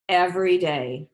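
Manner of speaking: ordinary delivery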